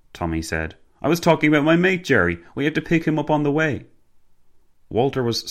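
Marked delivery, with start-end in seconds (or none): 1.0-3.8 fast, high-pitched Cockney patter; Cockney driver, explaining. Acted. Driver explaining his mate Jerry to his passenger, quick and breezy. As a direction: rattle it off as the driver.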